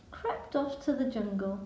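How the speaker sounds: ordinary delivery, nothing marked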